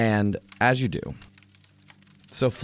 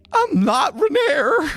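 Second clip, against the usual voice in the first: very high, slow, squeaky protest; a frightened little man setting things straight; squeaky Floon; 7 dB louder, slower than usual. Acted. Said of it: "Floon's like".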